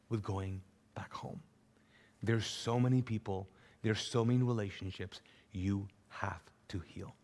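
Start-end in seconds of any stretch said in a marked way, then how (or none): 0.1-1.4 soft whispered words. Real, not acted. Preacher drops to intimate hush. 3.8-7.1 whisper. Real, not acted. Preacher drops to intimate hush.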